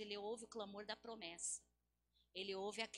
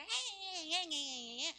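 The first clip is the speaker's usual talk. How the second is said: whiny fake bawling; drawn-out hammed-up sobbing wail; mock crying; 7 semitones higher, 7 dB louder, slower than usual. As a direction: imitate a crybaby's wail. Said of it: "ficar chorando aí".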